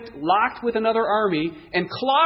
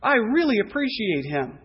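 impressed, drawn-out higher wow; admiring wonder; 4 semitones higher than usual. Acted. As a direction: marvel at him, voice lifted. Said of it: "He looked at David and said".

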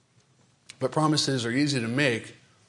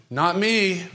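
lightly voiced boy, a bit higher; boyish denial; 5 semitones higher, 4 dB louder than usual. Preacher voicing Peter as a boy saying not me. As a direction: brush it off like a little boy. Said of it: "what does Peter say boyishly".

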